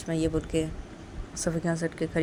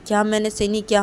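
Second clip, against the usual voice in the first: indignant challenge; 4 semitones higher, 7 dB louder than usual. Acted. Indignant reply to a reproach.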